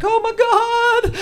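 put-on very high, slow squealing teen girl; spoiled girl. Spoiled girl thrilled at her Zorse, very high and slow. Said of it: "She's like".

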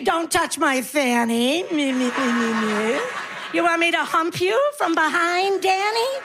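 lightly played deaf old woman, voice rising to the end; pretend deaf mishearing. Comedian pretends to be deaf, answering a heckler with mock mishearing. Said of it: "I pretend I'm deaf".

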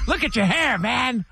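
mock the son's band hair like a disgusted dad; dad's mocking taunt. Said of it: "Do the impression".

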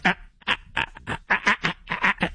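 soft breathy interrupting ahs; mock huffing. Imitating a host huffing to get a word in. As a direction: puff like an impatient host waiting to cut in. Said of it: "huffing and puffing to get in".

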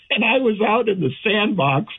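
high, laughing through the words. Real, not acted. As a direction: chuckle as you say it. Laughing about the heat.

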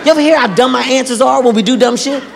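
ordinary delivery

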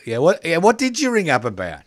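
pressing question, pitch up; impatient; high, loud and impatient. Real, not acted. Host prompting the caller.

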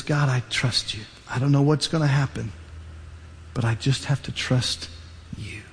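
a mother's hushed plea to God; quiet trusting prayer. Acted. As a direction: pray it quietly, letting go. Mother prays over the basket.

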